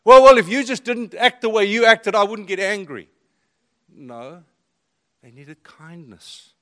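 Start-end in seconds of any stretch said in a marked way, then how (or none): 0.0-3.1 angry retort. Retold or imitated. Voicing his old angry self.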